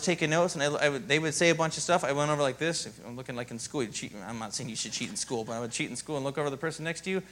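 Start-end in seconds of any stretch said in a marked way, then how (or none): none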